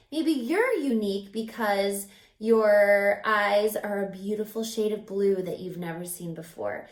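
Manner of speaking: ordinary delivery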